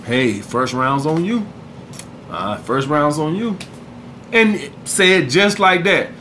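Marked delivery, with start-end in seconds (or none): 0.0-3.6 low, soft and slow, smooth; soft seductive line, pitched lower. Shown as a demonstration. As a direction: say it low and smooth like you're flirting. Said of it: "seductive voice".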